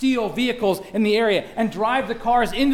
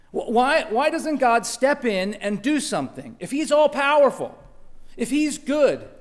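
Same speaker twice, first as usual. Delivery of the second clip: raised, louder demands for an answer; angry questioning; 4 semitones higher, 5 dB louder than usual. Acted. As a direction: challenge God in frustration. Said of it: "we find ourselves angry".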